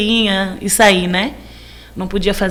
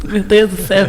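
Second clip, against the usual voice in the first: laughing. Real, not acted. Laughs at her own joke.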